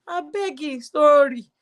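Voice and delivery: mild mocking scoff; mock dismissive voice. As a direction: brush it off like a sneering critic.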